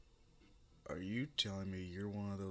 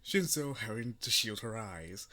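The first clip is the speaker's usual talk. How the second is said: usual speaking voice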